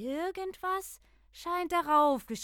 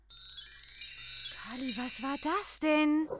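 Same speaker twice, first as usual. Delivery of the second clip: scared whisper; 3 semitones higher, 4 dB louder, much slower than usual. Acted. Spooky glow in dark hall.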